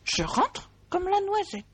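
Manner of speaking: small high mouse voice; high little mouse voice. A narrator's reading. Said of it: "dit Sourisette".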